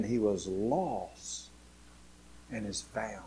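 very soft, with long pauses; hushed, slowing, falling away at the end; tender father. A narrator's reading. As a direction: reassure him gently, letting each phrase settle. Father reassures son in parable.

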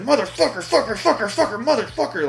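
high-pitched, repetitive freak-out; mock rage fit. Father freaks out at the locked door, mock rage fit. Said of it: "Freaking out. Having a real ca-, rage cage fit".

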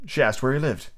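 usual speaking voice

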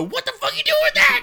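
very high squeaky "what the fuck"; mock shock at the tiny stick; incredulous outburst. Imitating Johnny mocking tiny twig.

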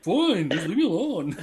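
high, drawn-out sulky child imitation; mock kid whining. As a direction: whine it slowly like a fed-up kid. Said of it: "whining or whatever".

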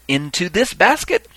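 slow, high ceremonial question; calling out. Acted. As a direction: draw it out to the crowd. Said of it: "then finally a third time".

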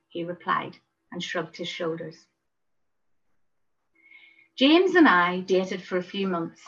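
ordinary delivery

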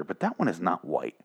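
ordinary talk

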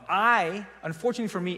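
usual speaking voice

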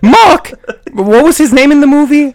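hammed-up British girl; horrible British girl voice. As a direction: play a bad falsetto English lady. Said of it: "horrible girl British voice".

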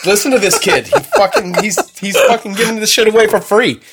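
excited praise. Real, not acted. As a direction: rave about him to everyone. Gushing praise, voice jumps up.

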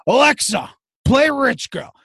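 high, loud call to the speaker; a high-pitched father ordering Alexa; old dad command. Retold or imitated. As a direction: bark a command at the device like an old man. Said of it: "And he's like".

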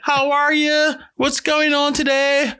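very high, slow, drawn-out phone greeting; fake-polite mom greeting, pitched sky-high; nice mom voice. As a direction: put on a syrupy representative voice for new people. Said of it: "they put that nice representative voice on".

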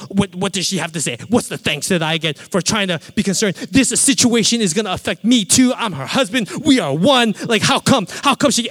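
self-righteous rant building to loud and high; indignant case-building. Retold or imitated. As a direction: pile up grievances, climbing in pitch and volume. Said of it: "I'm building a case against her".

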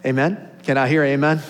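loud rising call for a response; excited yelling. Real, not acted. Pastor asking the congregation for an amen, excited loud call. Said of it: "I'll start yelling".

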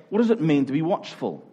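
ordinary talk, nothing marked

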